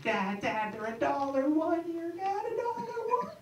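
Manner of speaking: put-on high, eager kid pitch rising to a squeal; a kid begging dad at the toy store; eight-year-old pestering his dad about toy prices; whiny excited kid. Kid voice climbing higher.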